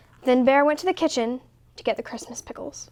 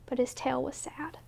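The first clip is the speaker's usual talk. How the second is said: whispered; 12 dB quieter than usual. A narrator's reading. Quiet narration of sadness.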